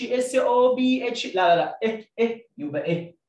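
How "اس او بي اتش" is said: letter names "اس او بي اتش" chanted high; rote spelling demo. Shown as a demonstration. Teacher showing how not to memorise at home, high rote spelling. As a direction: rattle off "اس او بي اتش" on a raised pitch. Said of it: "ما تقولش".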